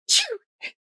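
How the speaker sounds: a loud, high playful fake sneeze; mock sneeze. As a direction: sneeze it out for the kids. Bear sneezes in picture book.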